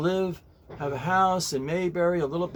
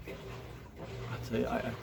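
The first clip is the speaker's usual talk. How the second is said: whispered aside; 3 semitones lower, 13 dB quieter, slower than usual. Real, not acted. Worked up about HOA rules.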